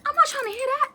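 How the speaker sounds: put-on high, loud childish protest; hammed-up bratty wail; whiny refusal. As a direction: squeal it like a kid who won't listen.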